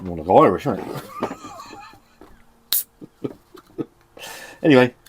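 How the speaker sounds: ordinary talk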